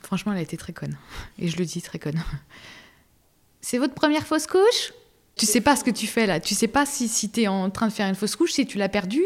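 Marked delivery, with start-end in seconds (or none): 0.0-2.2 unvoiced whisper, a quick confiding dig; whispered jab at the nurse; whispered aside. Real, not acted. 3.6-6.4 imitating the nurse's tactless question; mocking the nurse. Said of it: "la première question qu'elle me pose".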